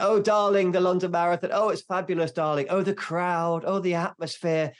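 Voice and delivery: high, loud London luvvie gush. Acted. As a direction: put on a gushing, high-pitched London darling. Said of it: "it's very London. Like".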